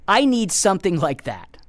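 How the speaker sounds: ordinary delivery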